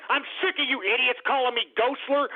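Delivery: usual speaking voice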